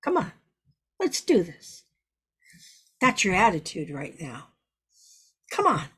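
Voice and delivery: a no-fuss leader getting you moving; the boss pushing the group to hit it hard; firm boss. Acted. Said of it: "the boss has a much firmer voice".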